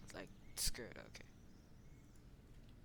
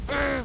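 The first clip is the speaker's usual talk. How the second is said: high, loud hammed-up cry; pretend pained grunt; 12 semitones higher, 12 dB louder than usual. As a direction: play at being struck hard. Figures hit in play fight.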